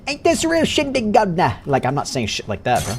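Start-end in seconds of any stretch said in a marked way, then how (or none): 0.1-1.6 high, hammed-up mock British accent; a bad British accent put on for a joke. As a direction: parody a British toff accent. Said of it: "I'm not out here saying".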